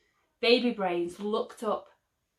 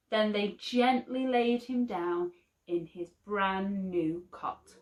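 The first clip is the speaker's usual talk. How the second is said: ordinary delivery, nothing marked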